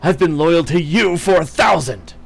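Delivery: loud, high raging shouts; furious tirade. Acted. Woodwose beating the queen, raging loud shouts. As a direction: scream every line in betrayed fury. Enraged tirade while attacking.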